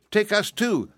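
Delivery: tiny piglets crying out. A narrator's reading. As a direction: plead like an eager little piglet. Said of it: "cried the nine tiny piglets all in one breath".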